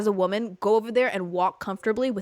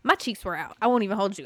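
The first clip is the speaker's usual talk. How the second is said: usual speaking voice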